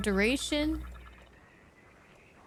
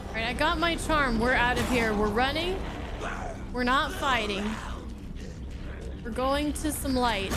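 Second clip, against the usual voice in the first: being chased; 2 semitones higher than usual. Real, not acted. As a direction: rush it out like something's behind you. Fleeing enemies in game.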